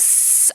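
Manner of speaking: a sharp whispered hiss like a vampire shrinking from light. Retold or imitated. Aries playing a sun-hating girl, hissing. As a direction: hiss at the sunlight. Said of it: "a girly who hisses at sunlight".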